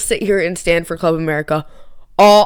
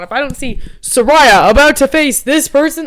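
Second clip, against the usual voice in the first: emphatic; 6 semitones higher, 8 dB louder than usual. Real, not acted. Emphatic rant about brackets.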